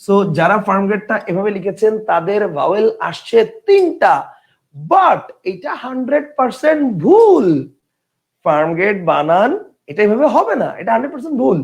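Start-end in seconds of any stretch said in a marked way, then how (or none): 4.8-7.5 slow, high, stretched stress on ভুল; emphatic verdict. Real, not acted. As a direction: declare it wrong, leaning long on ভুল. Teacher declares a spelling wrong.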